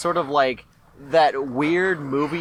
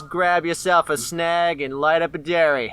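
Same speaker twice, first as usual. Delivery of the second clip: put-on Australian accent; 3 semitones higher than usual. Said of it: "our terrible Australian accents".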